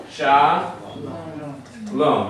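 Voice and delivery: "Sha, Lom" split by a long pause; each syllable held on its own. Shown as a demonstration. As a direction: sound out "Sha", stop, then "Lom". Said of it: "One syllable at a time".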